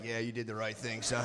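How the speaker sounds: low, hushed fatherly voice; imitated dad. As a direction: drop deep and soft like a dad confiding. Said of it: "He'd be like".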